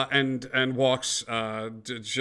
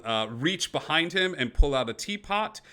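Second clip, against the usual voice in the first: usual speaking voice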